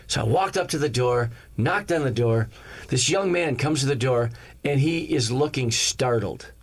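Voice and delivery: ordinary talk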